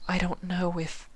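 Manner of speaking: high, soft and unsure; hesitant Twilight. Acted. Twilight unsure with Sympathy, high soft trailing voice. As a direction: trail off in doubt.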